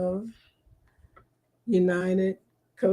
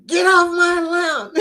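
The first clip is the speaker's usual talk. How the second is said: mock grumpy old man; 8 semitones higher, 4 dB louder than usual. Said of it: "he's like".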